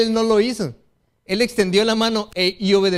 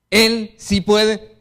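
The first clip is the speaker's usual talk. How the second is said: Él sí puede very slow and louder, stress on Él; slow emphatic; 5 dB louder, much slower than usual. Real, not acted. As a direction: drag out Él sí puede with weight. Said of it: "slow emphatic answer, louder".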